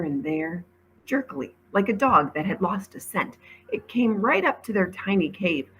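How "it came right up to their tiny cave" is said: ordinary delivery, nothing marked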